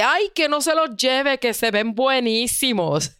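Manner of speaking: joking cousin. Retold or imitated. Cousin jokes with the waiter, playful. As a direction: tease the waiter to leave the calamari. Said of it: "muy jocoso".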